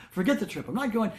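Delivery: clipped, heated, rising refusal; retold angry refusal. Bholenath calling off the Kailash trip, heated angry refusal. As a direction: slam the door on the trip. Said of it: "He was just so angry".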